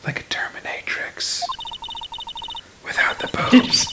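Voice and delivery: breathy whispered punchline; whispering. Acted. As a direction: whisper the joke aside.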